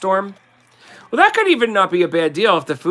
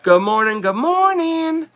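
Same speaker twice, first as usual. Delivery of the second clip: Jersey good morning; 11 semitones higher than usual. Shown as a demonstration. Host to viewers, trying out her old Jersey-accented greeting. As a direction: sing the second "morning" up high and stretched. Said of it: "I gotta see how I used to say it".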